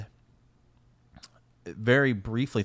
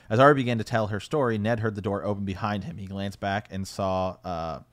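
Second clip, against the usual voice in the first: ordinary delivery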